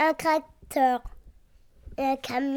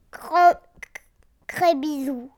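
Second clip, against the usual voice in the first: ordinary talk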